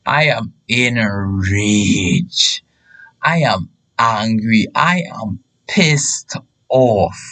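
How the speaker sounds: drawn-out, low-pitched declaration; slow, heavy and deliberate; slow, simmering rage. Retold or imitated. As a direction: spell out your anger word by word, slowly. Declares his rage in the retelling.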